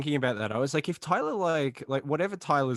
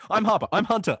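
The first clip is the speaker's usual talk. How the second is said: high, loud mock introductions; mock co-host intros; 8 semitones higher, 6 dB louder than usual. Co-host mimicking two hosts talking over each other, loud mock intros. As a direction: imitate two hosts jumping in at once. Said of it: "both at the same time be like".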